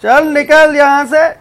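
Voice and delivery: taunting. Real, not acted. Taunting enemy in game.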